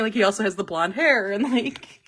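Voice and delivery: usual speaking voice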